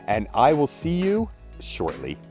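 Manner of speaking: ordinary delivery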